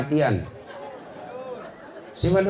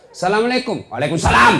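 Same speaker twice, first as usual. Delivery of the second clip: firm, higher and louder greeting; 6 semitones higher, 4 dB louder, faster than usual. Shown as a demonstration. Girl answering her boyfriend's call firmly, loud and brisk. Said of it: "Coba kau tegas".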